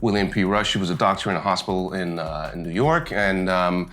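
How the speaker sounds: put-on American accent, British actor auditioning. Shown as a demonstration. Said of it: "give us your best American accent".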